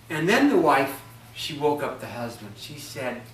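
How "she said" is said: plain reading voice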